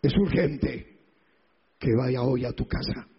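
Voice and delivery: un toque de Cristo, más grave y pausado; voz de Cristo. Acted. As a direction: como Jesús llamando en voz baja y tierna. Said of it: "Como que me diga a mí". (voz de Cristo grave y pausada)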